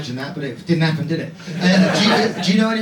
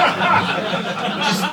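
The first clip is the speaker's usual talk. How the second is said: ordinary talk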